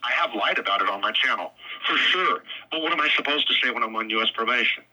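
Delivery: normal talking voice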